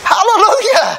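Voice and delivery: high, loud shout of praise; exultant preacher's shout; jubilant shout. Real, not acted. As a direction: cry it out in triumph to the congregation. Preacher celebrates a conversion.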